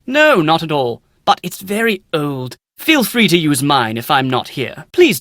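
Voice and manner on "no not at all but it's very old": ordinary talk, nothing marked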